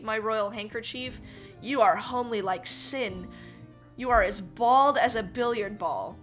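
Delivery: dumbfounded king. Acted. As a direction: challenge him in loud disbelief. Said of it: "utterly dumbfounded".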